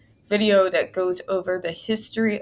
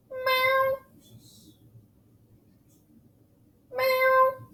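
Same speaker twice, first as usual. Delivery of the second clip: high little cat meow. Real, not acted. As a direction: mew up high like a cat answering a cat. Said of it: "Yes, I meow at my cat".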